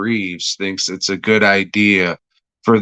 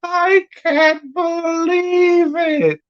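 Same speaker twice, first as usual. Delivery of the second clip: mock disbelief; slower than usual. Mock shocked character.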